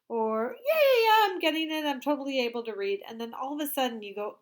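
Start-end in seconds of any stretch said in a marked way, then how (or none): 0.6-3.0 put-on high, loud, fast cocky kid voice; mock breezy confidence. Teacher acting a breezy, overconfident student to her class. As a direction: play a kid who's sure he's got it.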